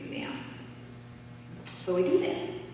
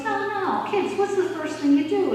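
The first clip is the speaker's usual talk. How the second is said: put-on higher, louder urging "no, no, kids"; insistent teacher; 11 semitones higher, 6 dB louder than usual. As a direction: push the kids toward the answer, rising and pressing. Teacher pushes kids toward answer.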